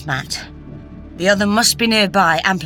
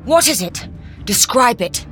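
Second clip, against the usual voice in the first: quick firm command, a bit higher; urgent order; 6 semitones higher, 4 dB louder than usual. A narrator's reading. Doctor ordering Matt to describe it, urgent. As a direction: demand an answer. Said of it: "ordered the doctor".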